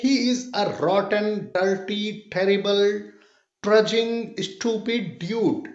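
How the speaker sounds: very slow, each harsh word drawn out; slow example sentence. Shown as a demonstration. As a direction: lean on every ugly word slowly. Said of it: "अब इसको example से समझते हैं". (कठोर शब्दों पर धीरे-धीरे जोर)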